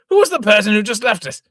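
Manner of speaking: loud, high astonished demand; alarmed, loud question; stunned and loud; shocked outcry. Acted. Father shocked by the trick.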